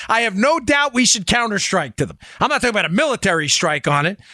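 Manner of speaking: ordinary talk, nothing marked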